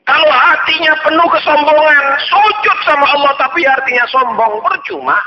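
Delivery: impassioned preacher; fiery preaching. Acted. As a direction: drive the warning home to the congregation. Preaching at full force.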